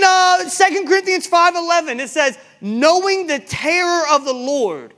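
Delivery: high, forceful pulpit cry; full-force preaching. Real, not acted.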